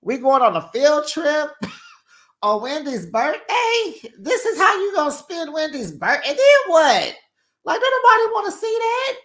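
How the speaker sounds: high, incredulous mock outrage; high-pitched disbelieving cast members; mock outraged cast. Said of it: "everybody's looking like".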